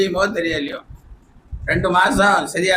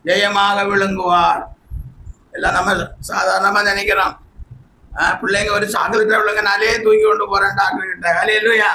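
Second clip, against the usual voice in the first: ordinary delivery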